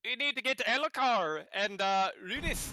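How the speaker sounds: high, loud Irish brogue; Irish accent. Acted. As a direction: call it out in stage Irish. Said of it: "let's get right into the Irish accent".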